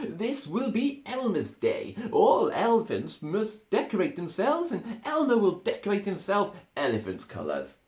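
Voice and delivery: high, loud, eager elephant voice; another elephant. Acted. Another elephant proposes the celebration, high and eager. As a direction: announce it proudly. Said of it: "said another elephant".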